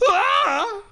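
very high-pitched theatrical wail; wailing scream. Acted. Kid horrified by the growling baby, high acted wail. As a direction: shriek out in acted terror. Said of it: "I wailed".

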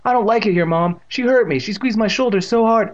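put-on high, loud whining boy; wailing complaint. A narrator's reading. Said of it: "Evan wailed".

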